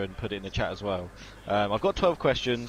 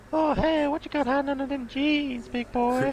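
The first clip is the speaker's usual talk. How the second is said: much higher, leering creep; creepy pervert voice; slower than usual. Pretend. Joking about a pervert character, leering falsetto. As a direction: purr it like a lecherous creep. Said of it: "be, like, a pervert".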